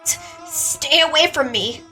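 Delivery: high, slow, stammering plea; frightened. Acted. Mion warding off Yoshimura, stammering and afraid. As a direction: stammer it out in fear.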